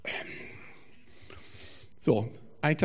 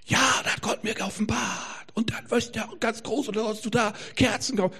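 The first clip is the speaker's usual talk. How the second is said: high-pitched parody of an overexcited prophet; hammed-up, high prophetic proclamation; mock shouted prophecy; 8 semitones higher than usual. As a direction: imitate a zealot crying out a revelation. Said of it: "werden so Dinge hineingeschrien".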